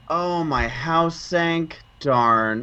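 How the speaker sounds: slow, understated reaction to bad news; mock-calm, drawn-out delivery with a dropped last word; unfazed calm. Imitating a film character shrugging off her sunken house, calm and collected. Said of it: "calm, cool, and collected as Carrie Fisher".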